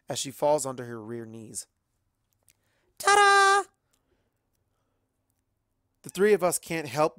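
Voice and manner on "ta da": very high, loud flourish; triumphant ta-da. Acted. Pinky landing the trick, high loud ta-da. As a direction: sing out the finale.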